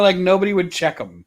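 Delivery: ordinary delivery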